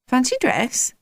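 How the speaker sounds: high, curious query; suddenly interested. A narrator's reading. Miss Whiz hearing of the dance, suddenly interested. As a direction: perk up at the idea. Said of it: "Miss Whiz looked interested".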